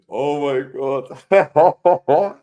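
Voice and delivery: high, loud, drawn-out exclamation breaking into laughter; laughing disbelief. Real, not acted. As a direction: crack up in real amazement. Cracking up at the uploaded clip.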